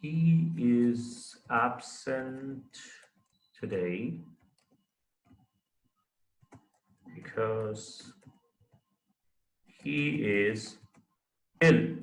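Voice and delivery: very slow, word by word with long gaps; drawn-out words, paced to handwriting; writing aloud. Shown as a demonstration. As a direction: say each word slowly as you write it out. Dictating to self while writing example.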